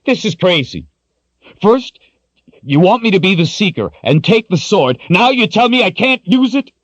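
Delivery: high, loud, outraged protest; furious. Acted. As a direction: hurl the unfairness back at him. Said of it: "Richard was furious".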